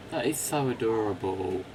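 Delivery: usual speaking voice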